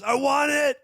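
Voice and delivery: high, mocking teen shout from a car; rowdy teen yelling. Teen leaning out of a car, taunting the canvasser, high mock shout. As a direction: yell it out the window like a bratty teen. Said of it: "And he goes".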